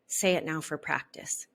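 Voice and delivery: usual speaking voice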